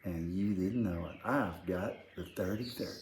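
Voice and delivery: hushed threat; quiet menacing quote. Retold or imitated. Kid to brother, hushed menacing threat. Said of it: "But it was like this".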